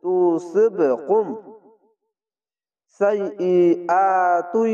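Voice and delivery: slow তুসিবকুম সাইয়্যাতুই, the ইয়া doubled; recited slowly. Shown as a demonstration. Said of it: "ইদগামে বা গুন্না". (ইদগাম গুন্না দিয়ে তেলাওয়াত)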